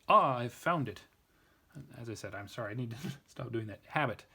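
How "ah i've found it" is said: louder, mock eureka exclamation; put-on cry of discovery; fake discovery. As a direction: pretend you've just found it, with a sudden loud "ah". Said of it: "another one of my fake".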